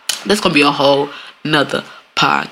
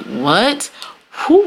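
ordinary delivery

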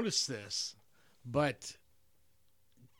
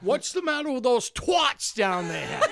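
put-on high, loud snooty girl yell, slowed; mock brat shout; 8 semitones higher, 7 dB louder, slower than usual. Quoting the movie girl to friends, high loud shout. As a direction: bellow the line like a spoiled girl. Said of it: "that was her quote".

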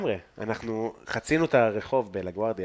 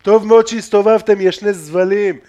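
retold heckler, loud and high; shouted insults; 6 semitones higher, 8 dB louder than usual. Teller imitates the driver cursing at them, shouting. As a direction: bellow it as the abusive stranger, raised pitch. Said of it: "קללות".

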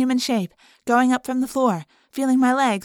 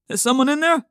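calling out; 4 semitones higher, 5 dB louder than usual. Acted. Father calling from barn door.

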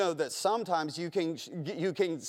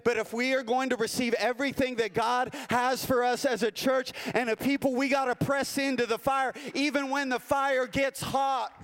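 higher, slowing on "even when the fire gets hot"; full-force preaching; 4 semitones higher than usual. Acted. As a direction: preach it home, driving each phrase.

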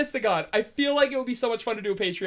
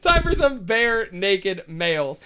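announcer voice; 3 semitones lower, 4 dB louder, much slower than usual. Acted. Announcing the mail segment.

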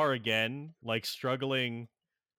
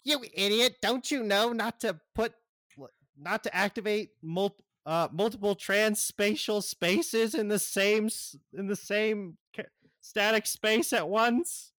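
Gizmo scolding; 9 semitones higher than usual. Acted. Gizmo scolds Cyborg about transspatial spaces, high frantic rant. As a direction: berate him like a panicked little genius. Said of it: "he's like".

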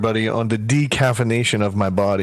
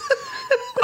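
high laugh; 4 dB quieter than usual. Real, not acted. Laughs at acid wash.

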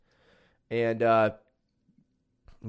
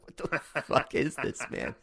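breathy half-whisper, almost unvoiced; whispered aside; 3 semitones higher, 6 dB quieter than usual. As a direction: mutter it to yourself in disbelief. Stunned thought at family Hooters.

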